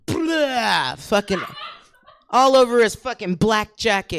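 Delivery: loud mock spit-out then a shouted complaint; loud spitting outburst. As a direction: blurt it out loudly like spitting. Retelling spitting it out.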